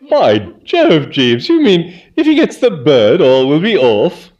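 put-on young gent seeing daylight, thrilled; excited Bertie. A narrator's reading. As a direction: catch on with a jolt of glee.

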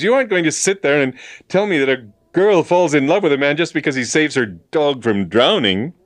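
fast, high, loud objection; incredulous protest. A narrator's reading. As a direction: object hotly in a rush. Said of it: "protested James".

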